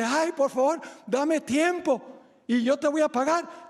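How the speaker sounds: high, urgent begging; mock pleading. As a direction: beg for time like the crybaby debtor. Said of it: "hace lo mismo que había hecho él".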